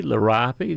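liriope said as luh-RYE-uh-pee; liriope, luh-RYE-uh-pee, final ee sounded; variant pronunciation. Shown as a demonstration. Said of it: "so many ways to pronounce".

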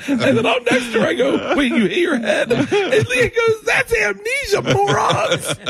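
very high, laughing squeal; real laughter overtaking the story; cracking up. Real, not acted. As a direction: shout the punchline through helpless laughter. Cracking up telling the punchline.

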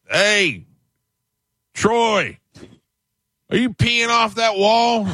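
high, drawn-out yell across the beach; mock yell. Narrator mimicking a dad yelling at his son.

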